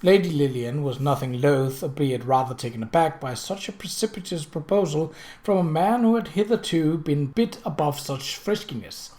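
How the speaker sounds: ordinary delivery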